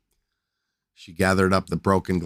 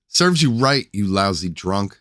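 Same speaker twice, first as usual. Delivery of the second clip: spat angrily; 4 dB louder than usual. Acted. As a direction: spit the words out in anger. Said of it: "she spat".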